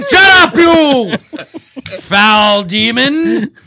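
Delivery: high then slow loud rebuke; villainess rebuke. Acted. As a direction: snap at the servant grandly. Villainess snapping at servant.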